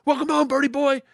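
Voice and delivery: high, carrying call from a window; students calling out. Retold or imitated. Kids leaning out of windows, calling out a welcome. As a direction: hail him like cheering students.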